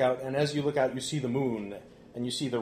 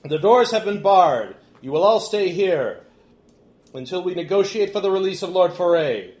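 loud, higher-pitched proclamation; Remy, commanding orator; 6 semitones higher, 9 dB louder than usual. Acted. Remy tells the guests they are held, commanding orator. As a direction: announce terms to a captive crowd. Remy's announcement to hall.

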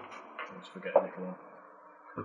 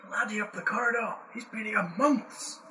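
a lightly done Scottish dwarf, higher than usual; pleading dwarf in fake Scottish; fake Scottish accent; 6 semitones higher than usual. Prisoner dwarf explains himself to the party, light Scottish accent. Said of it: "You and your fake Scottish accent".

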